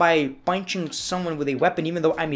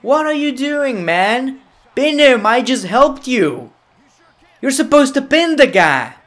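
high, loud scolding; exasperated yell; 6 semitones higher, 6 dB louder than usual. Real, not acted. Gamer scolds wrestler who won't pin, exasperated yelling. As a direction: shout in disbelief at a useless partner.

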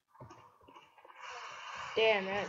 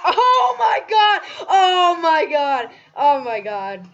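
ecstatic screaming; 2 semitones higher, 8 dB louder than usual. Real, not acted. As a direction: scream it out in disbelief. Ecstatic screaming after big moment.

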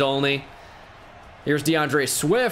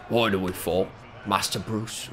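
low, quieter stab at the butler; gravely earnest mock butler line; corrected take, still a light butler impression; Alfred from The Batman; 6 semitones lower, 5 dB quieter than usual. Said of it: "But he's like".

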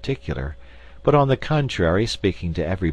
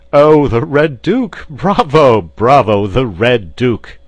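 loud, high cheering; delighted cry; 6 semitones higher, 10 dB louder, slower than usual. A narrator's reading. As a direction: shout bravo with relish, drawing it out. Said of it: "cried Porthos, clapping his hands".

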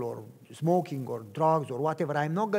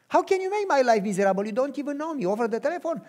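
higher, fast indignant retort; indignant girl; 8 semitones higher, faster than usual. Retold or imitated. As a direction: fire back like an offended young woman. Girl's indignant reply on phone.

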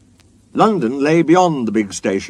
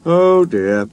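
mildly sad, raised-pitch lament; 6 semitones higher, 4 dB louder than usual. A narrator's reading. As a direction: sigh it as a small lament. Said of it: "he thought sadly".

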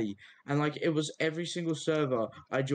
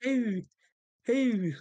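high squeaky mock Scottish "How?"; mock Scottish accent; 7 semitones higher, 5 dB louder, much slower than usual. Mocking Scottish accent, repeated.

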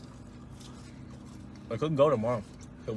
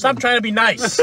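teasing scold; 8 semitones higher, 6 dB louder, slower than usual. Real, not acted. Teasing friend over food.

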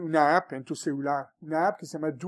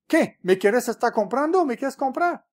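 higher, louder affronted challenge; mock outrage, pitched up; indignant police officer; 6 semitones higher, 5 dB louder than usual. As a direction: act out an officer offended by a bribe. Acts out indignant Canadian police officer.

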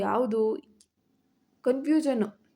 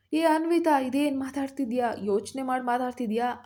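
plain reading voice

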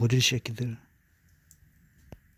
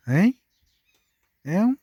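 cutesy calling; 8 semitones higher, 4 dB louder than usual. Real, not acted.

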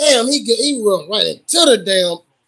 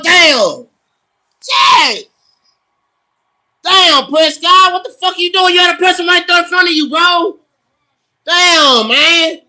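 high, loud fan outburst; exasperated shouting; 7 semitones higher, 5 dB louder than usual. Real, not acted. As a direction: yell at the player in disbelief. Angry at the quarterback's bad play.

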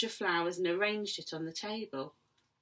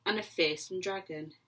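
usual speaking voice

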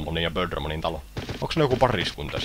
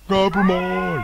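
much higher, louder call of its own name; proud creature cry; 12 semitones higher, 6 dB louder, much slower than usual. Acted. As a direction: shout your name like a cartoon monster. Monster ruft seinen Namen.

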